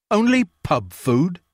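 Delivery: indignant landlord repeating "Only pub food?"; affronted echo. A narrator's reading. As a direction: echo her words back, stung. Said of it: "soundless affront".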